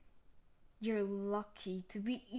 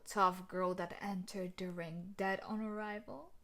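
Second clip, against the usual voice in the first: ordinary talk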